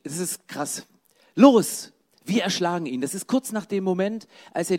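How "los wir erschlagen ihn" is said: rallying cry, punched at the start; urging call to attack. Acted. Brothers plotting against Josef, urging call. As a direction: burst out loud on the first word. Reads the brothers' murderous plot.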